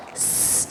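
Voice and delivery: s hissed downward, narrow; a thin one-way s; sin demo. Shown as a demonstration. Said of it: "kita makin merasanya s".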